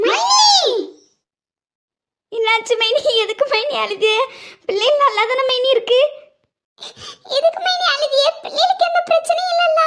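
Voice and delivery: put-on pleading to hear the kids are fine; growing alarm; anxious questions. Friend panicking, asking why crying.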